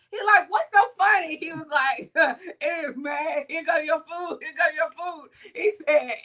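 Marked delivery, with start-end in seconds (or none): 0.5-1.4 retold challenge. Friend challenges the laughing worker. 2.6-5.3 light imitation of a giggling worker; retold giggling. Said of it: "he giggling, he laughing".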